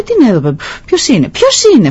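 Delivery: put-on high-pitched little boy calling out; startled shouted question. Startled boy calls out to the unknown voice, shouting. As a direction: call into the mountains, startled. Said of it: "φωνάζει".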